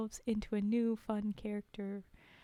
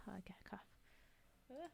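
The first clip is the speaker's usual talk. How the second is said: ordinary talk, nothing marked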